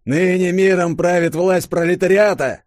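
high, loud triumphant slogan; soldier proclaiming. A narrator's reading. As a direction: bark the slogan at the crowd. (солдат громко провозглашает лозунг)

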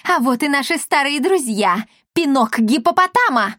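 high, theatrical villain's call; gloating cry. A narrator's reading. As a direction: call out to your old foes with relish. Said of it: "крикнула Бия, увидев Дарину и остальных". (злорадный выкрик высоким голосом)

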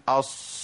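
أص slurred toward a sh sound; صاد مشوبة بشين. Retold or imitated. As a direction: imitate a faulty أص, its s turning into sh. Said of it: "الشين". (نطق أص خطأً بصوت قريب من الشين)

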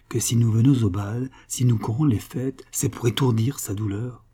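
usual speaking voice

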